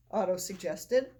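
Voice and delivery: ordinary delivery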